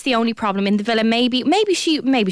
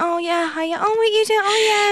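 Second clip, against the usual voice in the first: fake casual surprise; 9 semitones higher than usual. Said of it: "she's like".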